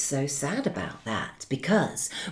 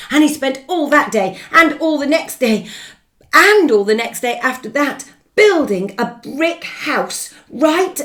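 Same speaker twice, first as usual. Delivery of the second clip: excited narration; 7 semitones higher, 9 dB louder than usual. A narrator's reading. Building the house excitedly.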